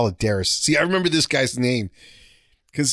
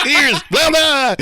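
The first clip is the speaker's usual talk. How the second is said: higher, louder nonsense shout; mock yell; 11 semitones higher, 6 dB louder, much slower than usual. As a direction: yell gibberish to blend in with the squad. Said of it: "I would just yell that out".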